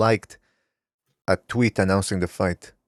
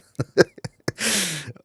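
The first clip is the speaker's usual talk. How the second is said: laugh; 8 semitones higher, 4 dB quieter than usual. Real, not acted. Cracking up at chat comment.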